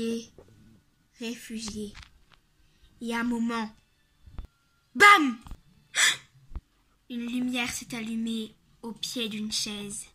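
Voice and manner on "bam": loud jump-scare bam; sudden shout. Acted. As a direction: shout it to startle.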